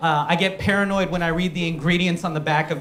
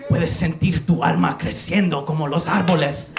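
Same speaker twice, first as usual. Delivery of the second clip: mock shaman incantation. Sons guide us through ayahuasca, mock mystical intoning. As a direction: intone it like a shaman guiding a trip. Healers intone over the drinkers.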